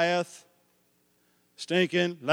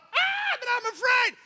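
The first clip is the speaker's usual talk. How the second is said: squeaky, much higher frightened protest; whiny fear. Retold or imitated. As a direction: whimper it like a scared follower. Voicing frightened disciple.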